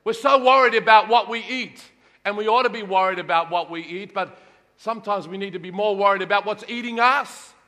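loud, high, ringing preacher's emphasis; preaching at full force. Real, not acted. Pastor to congregation, loud ringing emphasis. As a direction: drive the point home to the congregation.

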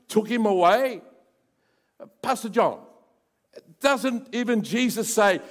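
loud, high, impassioned preacher; preaching at full force. Acted. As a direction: thunder it out to the congregation.

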